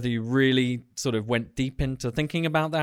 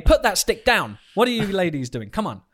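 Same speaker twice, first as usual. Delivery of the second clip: raised, higher mock telling-off; mock scolding; 7 semitones higher, 6 dB louder than usual. Host breaking up the two women's squabble, mock scolding. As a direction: playfully call the squabblers to order, louder.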